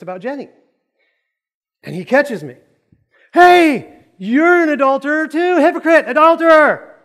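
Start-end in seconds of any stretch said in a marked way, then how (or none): 3.3-7.0 high, loud indignant yelling; shouted accusation. Acted. Tommy catching the preacher looking, high shouted accusation. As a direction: call him out at full volume.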